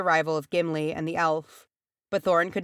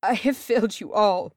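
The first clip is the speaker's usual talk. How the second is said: higher, anguished confession; tormented; 5 semitones higher, 4 dB louder than usual. A narrator's reading. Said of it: "his eyes were tormented".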